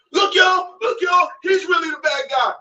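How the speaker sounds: high shout to crowd. Real, not acted. Calling out to viewers about the rival, high shout. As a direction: call out to everybody watching.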